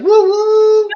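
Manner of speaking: high, slow cowboy whoop, way up in pitch; mock whoop. Joking about riding a kangaroo, a high drawn-out whoop.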